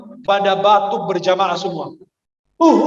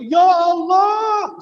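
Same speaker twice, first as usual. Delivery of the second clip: teriakan tinggi dan panjang, Ya Allah ditarik lambat; teriak meniru jamaah; 9 semitones higher, much slower than usual. Retold or imitated. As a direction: tiru jamaah yang berteriak memanjangkan Allah. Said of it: "sambil teriak". (teriakan Ya Allah yang panjang dan tinggi)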